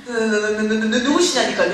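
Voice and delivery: loud, panicky stuttering demand; terrified stammer. Retold or imitated. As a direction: stammer hard, then blurt the question out loudly. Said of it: "얼마나 무서운지 알아요".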